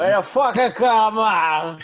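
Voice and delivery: high, loud mock mobster voice; hammed-up Italian American accent.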